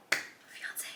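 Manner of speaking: unvoiced hushed whisper; breathy whisper. Real, not acted. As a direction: whisper the word in awe.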